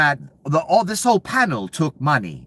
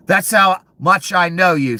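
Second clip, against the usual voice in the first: angry; 6 semitones higher, 7 dB louder than usual. Real, not acted. Ranting at the official.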